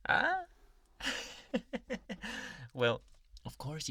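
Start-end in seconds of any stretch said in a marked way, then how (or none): 0.1-0.4 mock surprise. Feigned surprise at patient's face. 1.0-2.7 breathy delighted giggle; little possessive giggle; soft creepy giggle. Acted. Giggles after teasing.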